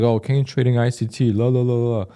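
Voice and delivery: sing-song mocking of the crowd. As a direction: brush it off with a taunting la la la. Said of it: "everyone's like".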